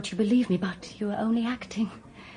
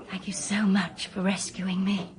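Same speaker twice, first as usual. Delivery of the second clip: ordinary talk, nothing marked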